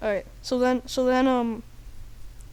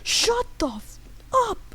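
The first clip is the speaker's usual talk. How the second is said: higher, drawn-out mock fury; hammed-up tough-guy command; Samuel Jackson; 10 semitones higher than usual. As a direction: break the phrase up for menace. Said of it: "Samuel Jackson goes".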